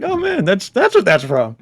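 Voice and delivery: high, excited aha exclamation; excited realisation. Retold or imitated. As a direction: suddenly get it, voice jumping up. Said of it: "I'm like".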